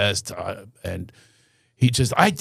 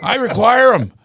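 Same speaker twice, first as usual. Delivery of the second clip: higher-pitched mock of a boastful dad; boastful father; 7 semitones higher than usual. Self-styled King of the Poconos brags to his son, boastful. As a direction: imitate dad holding forth, pitched up. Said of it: "He used to say to me".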